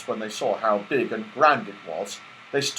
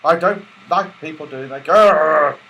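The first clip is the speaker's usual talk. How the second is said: growling; 6 dB louder than usual. Acted. Moonface pressed in the middle, growling at the pixie. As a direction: growly grumble. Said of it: "Moonface growled deeply".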